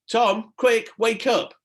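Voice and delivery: high, loud urgent call; urgent waking. A narrator's reading. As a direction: shake him awake with your voice.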